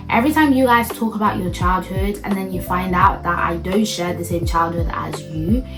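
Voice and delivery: usual speaking voice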